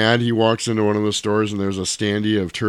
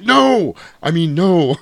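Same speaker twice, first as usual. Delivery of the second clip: panicked no; 9 semitones higher, 8 dB louder, slower than usual. Retold or imitated. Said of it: "He's like".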